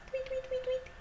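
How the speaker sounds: high, soft little tweets; playful high bird imitation; bird tweeting. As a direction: chirp like a small bird. Imitates birds for children.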